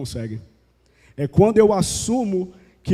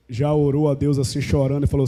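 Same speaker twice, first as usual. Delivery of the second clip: usual speaking voice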